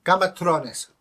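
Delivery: put-on loud, high "Game of Thrones" in an Eastern European accent; thick Eastern European mispronunciation. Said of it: "an Eastern European bloke".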